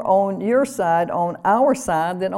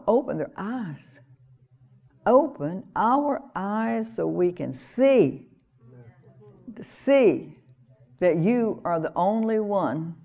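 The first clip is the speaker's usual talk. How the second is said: earnest prayer; slower than usual. Real, not acted. Praying aloud for the congregation.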